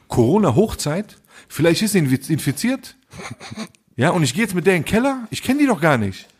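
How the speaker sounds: high-pitched mock paranoia; alarmed disbelief. Man imagines following a stranger to the cellar, acted alarm. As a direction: spiral upward into incredulous worry.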